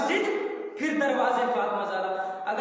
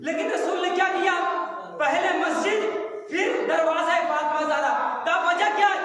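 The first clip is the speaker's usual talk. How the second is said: raised, shouted sermon lines; fiery preaching; 4 semitones higher than usual. Acted. Preacher urging the majlis crowd, at full force. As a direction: drive each clause home like a pulpit climax.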